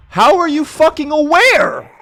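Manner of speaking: high, loud outraged yell; outraged shout. Real, not acted. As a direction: shriek it in disbelief. Zombie sees him, outraged.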